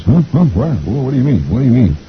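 flustered husband. A narrator's reading. Poor old mister answers his wife, flustered. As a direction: stammer like a bewildered husband. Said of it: "poor old mister says".

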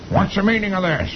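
angry general. Acted. General confronts the whole army, high indignant voice. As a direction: demand it like a baffled general. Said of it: "the general says".

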